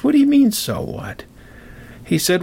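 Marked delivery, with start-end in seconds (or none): 0.0-1.2 high-pitched incredulous retort; indignant disbelief. Acted. Apprentice answering his dismissive master, indignant disbelief. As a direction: act taken aback, voice jumping up.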